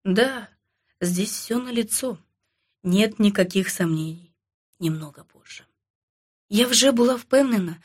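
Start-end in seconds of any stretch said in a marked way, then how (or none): 0.0-5.6 low, soft confidential voice, sinking toward the end; hushed murmur. A narrator's reading. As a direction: speak furtively, keep it down. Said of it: "доктор Цур мовив пошепки". (тихо, майже пошепки)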